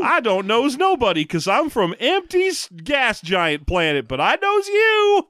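slow, high cartoon prospector drawl; old prospector. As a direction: ham up a backwoods old miner. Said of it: "he talks like an old prospector".

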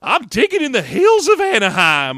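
put-on loud high slow old-timer; old prospector. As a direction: holler like a gold miner. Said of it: "you become an old prospector".

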